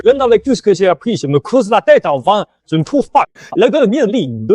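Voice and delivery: high, strained racking of memory; agitated frustration. Acted. Scholar cannot recall the poem's line, agitated frustration. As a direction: wail in vain over a lost line. Cherche en vain le vers manquant.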